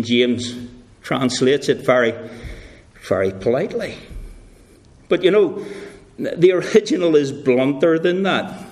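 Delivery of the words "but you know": normal talking voice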